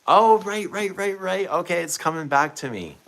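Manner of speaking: high, loud burst of oh right, it's all coming back; sudden recollection. Real, not acted. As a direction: jump way up in pitch as the memory hits. Suddenly remembering the color talk.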